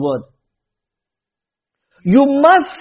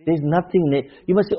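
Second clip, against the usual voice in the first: normal talking voice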